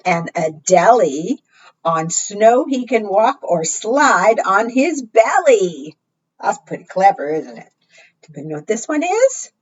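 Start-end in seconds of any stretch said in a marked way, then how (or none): none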